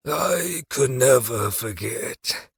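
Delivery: slow, hollow dying croak; corpse voice. A narrator's reading. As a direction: drag it out like a corpse. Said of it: "that awful voice, that corpse voice".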